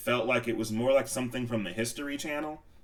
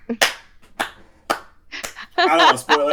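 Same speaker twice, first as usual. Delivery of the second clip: much higher and louder; teasing outburst; 7 dB louder, faster than usual. Real, not acted. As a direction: tease playfully.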